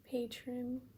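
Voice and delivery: usual speaking voice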